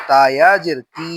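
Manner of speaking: তি with a long i; তি held long; stretched vowel. Shown as a demonstration. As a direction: draw out the vowel of তি. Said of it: "জেরে টান দিব".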